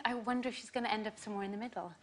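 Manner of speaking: usual speaking voice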